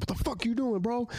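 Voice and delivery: a high, indignant "what are you doing", played back; retold outraged yell. Confronting the thief, retold.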